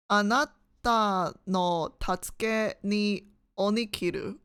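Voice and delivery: slow "Anata no tatsuke ni onikiru", with gaps between words; halting sounding-out. Shown as a demonstration. Exam taker trying out "Anata no tatsuke ni onikiru" in Japanese, slow and halting.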